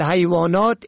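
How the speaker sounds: ordinary delivery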